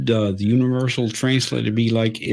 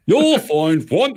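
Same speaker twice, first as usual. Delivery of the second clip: put-on higher, drawn-out British accent; 7 semitones higher, 4 dB louder than usual. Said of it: "It's that British voice too".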